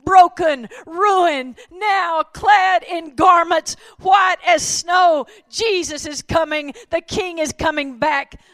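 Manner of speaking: loud, high, slow sermon climax, shouted out; ringing sermon cry rising to a shout; full-force preaching. Acted. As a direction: preach it to the rafters, stretching every line.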